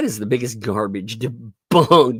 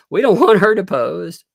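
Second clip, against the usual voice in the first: louder, hammed-up panicked plaintiff's line; loud mock panic; 4 semitones higher, 8 dB louder than usual. Mocking the panicked plaintiff to viewers, loud. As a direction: blurt it out like the panicking plaintiff side. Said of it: "he's probably panicked because he's like".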